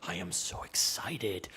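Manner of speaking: slow breathy whisper; mock whisper. Streamer whispering excitement about BlizzCon, slow whisper. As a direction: hush it out like a secret thrill. Whispered after fake weeping.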